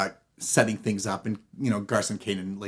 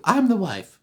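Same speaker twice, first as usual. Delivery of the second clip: high, insistent claim; second wife; 8 semitones higher, 4 dB louder than usual. Acted. Second wife contradicting the first in court, insistent. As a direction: claim it in a raised voice.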